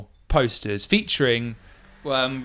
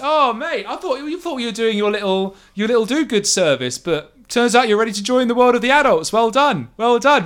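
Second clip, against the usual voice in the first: high, teasing mockery building louder; high mocking voice; 8 semitones higher, 4 dB louder than usual. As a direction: rib him like a smug older kid. GM voices mocking half-elf.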